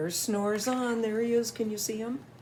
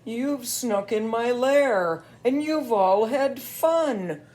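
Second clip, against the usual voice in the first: louder, complaining bear; grumpy bear voice; 4 semitones higher, 4 dB louder than usual. Acted. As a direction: sulk it like a tired bear. Bear speaks in story.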